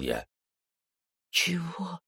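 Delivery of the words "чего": put-on high, outraged girl's "what?"; a shocked little sister snapping back; indignant teen girl's retort. A narrator's reading. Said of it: "возмутилась она".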